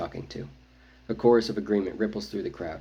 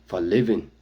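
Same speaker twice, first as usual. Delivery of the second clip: louder, firm warrior reply; a blunt cousin, firm and louder; firmly; 8 dB louder than usual. A narrator's reading. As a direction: plant the words like a command. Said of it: "he says firmly".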